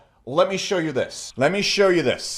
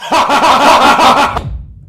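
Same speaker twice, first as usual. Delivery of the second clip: mocking laugh; 8 semitones higher, 11 dB louder than usual. Scoffing at the question.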